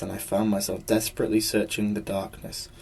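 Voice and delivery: ordinary delivery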